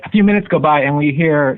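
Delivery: ordinary talk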